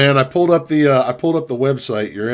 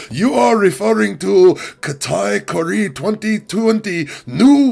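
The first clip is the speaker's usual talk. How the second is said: higher, slow mock Chinese-accented English, reciting a product title; AliExpress operator in broken English; 7 semitones higher than usual. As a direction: list the product name slowly like an eager operator with a put-on Chinese accent. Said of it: "my impression of the operator".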